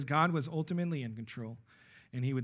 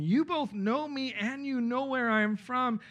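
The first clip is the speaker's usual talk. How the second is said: raised, slow declaration; measured public declaration, pitched up; crying out, as statement; 7 semitones higher than usual. A narrator's reading. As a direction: proclaim it slowly in a higher voice as a statement. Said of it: "Then Jesus cried out as he taught".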